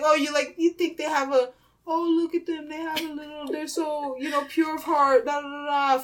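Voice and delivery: mock naive adoration. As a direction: coo as a starry-eyed believer, then trail into nonsense. Mocks people who idealise politicians.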